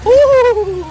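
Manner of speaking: loud high whoop; joyful woo. Real, not acted. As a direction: cheer out with relief. Celebrating found keys.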